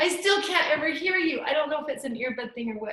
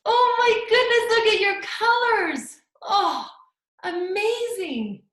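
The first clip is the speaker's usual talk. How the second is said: delighted praise; 8 semitones higher, 5 dB louder than usual. Real, not acted. Teacher praising child's art.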